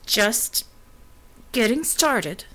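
strained, through clenched teeth; gritted teeth. A narrator's reading. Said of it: "she said through gritted teeth".

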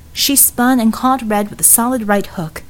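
ordinary delivery

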